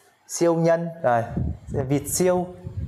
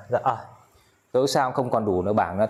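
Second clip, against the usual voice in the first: ordinary talk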